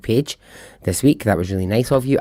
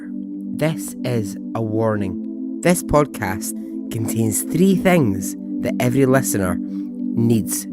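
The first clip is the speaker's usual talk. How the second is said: dramatic teaser read. Acted. Dramatic music-backed podcast teaser.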